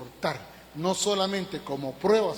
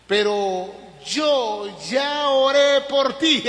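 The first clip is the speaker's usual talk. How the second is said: slow and higher, gently assuring Peter; the Lord's tender words; 6 semitones higher, 7 dB louder, much slower than usual. Acted. The Lord tells Peter he prayed for him, slow and tender. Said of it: "el Señor también dice".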